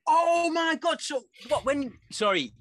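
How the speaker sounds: high, loud shocked exclamation; loud surprised outburst. Real, not acted. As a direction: blurt it out in sudden shock. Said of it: "you were loud in my headphones".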